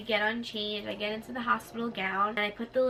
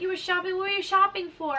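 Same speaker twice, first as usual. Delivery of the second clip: high-pitched imitated nurse questions; surprised questioning; 9 semitones higher, 4 dB louder than usual. Nurse to groggy patient about shopping, surprised questioning. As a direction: ask it high and raised, playing along.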